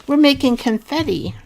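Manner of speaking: excited. Real, not acted. Excited craft announcement.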